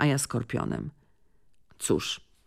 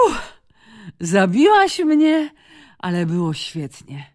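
breathless; 3 semitones higher, 3 dB louder than usual. Acted. Out of breath after the game.

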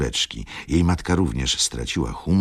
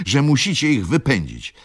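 high, loud demand; insistent interruption; 12 semitones higher, 5 dB louder than usual. A narrator's reading. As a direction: press the point heatedly. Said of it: "przerwała mi". (natarczywie, podniesionym głosem)

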